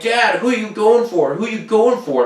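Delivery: mimicking the kids asking dad who he's rooting for; kids' voice. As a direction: play the kids pestering their dad. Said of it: "my kids are like".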